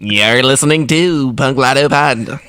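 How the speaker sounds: mock broadcaster intro; radio announcer voice. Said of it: "mine's gonna be an affectation".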